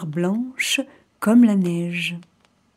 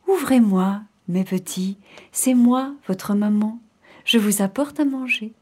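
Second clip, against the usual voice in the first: sweetened wolf voice. Acted. Wolf imitates mother at the door, gentle voice. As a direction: coax the kids in a gentle voice. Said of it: "sa voix était devenue toute douce".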